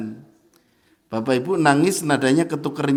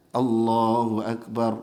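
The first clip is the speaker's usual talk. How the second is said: "Allahu Akbar" said short and even, with no stretch; short takbir; 6 semitones lower, 5 dB quieter, slower than usual. Shown as a demonstration. Teacher shows the proper short takbir before sitting. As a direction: keep the takbir brief. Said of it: "kalau pas mau salam".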